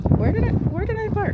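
high, loud puzzled question; surprised. Real, not acted. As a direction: pitch jumps up in sudden confusion. Suddenly wonders where her car is.

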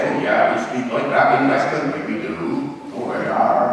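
West Country burr with a strong R; hearty West Country voice, rhotic; West Country accent. Retold or imitated. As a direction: imitate a West Country local, R's sounded. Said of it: "People from the West Country".

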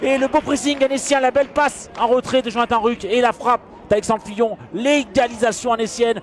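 loud, high equaliser shout; excited goal call. Real, not acted.

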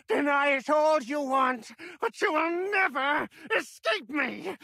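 high, threatening yell; menacing shout. Acted. Captured boss threatens Sonya, menacing shout. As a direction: roar the threat at her.

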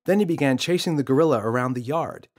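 plain reading voice

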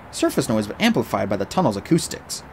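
ordinary delivery, nothing marked

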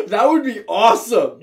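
high, loud delighted outburst; real excitement. Real, not acted. Host thrilled by co-host's killer rabbit idea, excited outburst.

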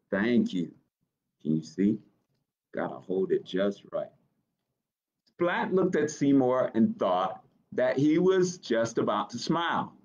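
ordinary delivery

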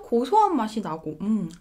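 talking while eating; muffled, savoring tone; mouth full of ice cream. Real, not acted. Seen 2: Spoon in mouth at 0.2, chewing until about 2.4 s.

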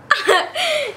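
short high laugh; high giggle. Real, not acted. Laughs at herself.

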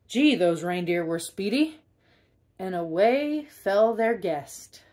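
ordinary delivery, nothing marked

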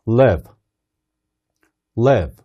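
"Live" clipped short with a lax, low vowel; short relaxed i. Shown as a demonstration. Teacher showing a short, relaxed "Live". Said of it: "짧게 끝낸다고 생각을 해보세요".

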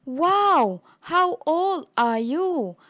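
loud, slow, excited wow; eager question. Acted. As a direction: ask the cat with wide-eyed eagerness. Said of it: "Thunder kata".

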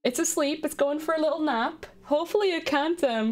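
ordinary talk, nothing marked

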